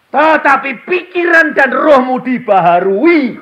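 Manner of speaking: high, loud, slow sermon shout; booming preacher voice; full-force preaching. Acted. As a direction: proclaim it to the whole hall.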